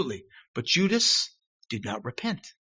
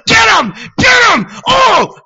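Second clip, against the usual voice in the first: loud, much higher shout at the rioters; yelling at the TV; 12 dB louder, slower than usual. Retold or imitated. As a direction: cheer on the fight at full volume. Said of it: "scowling and yelling at the T V".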